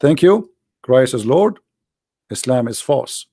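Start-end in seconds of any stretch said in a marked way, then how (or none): none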